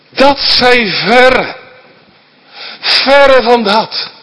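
luid, hoog en traag, elk woord uitgerekt; luide uitroep. Retold or imitated. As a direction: rek het luid en plechtig uit. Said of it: "roept hij uit". (langzaam en luid uitgeroepen)

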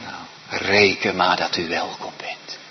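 soft reassuring whisper; preacher assuring the congregation in a whisper. Real, not acted. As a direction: lean in and whisper the promise.

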